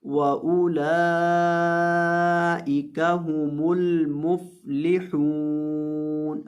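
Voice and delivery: slow, drawn-out tajweed chant; Quran recitation. Shown as a demonstration. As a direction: recite each word stretched and melodic.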